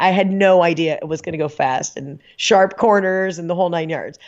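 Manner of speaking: ordinary talk, nothing marked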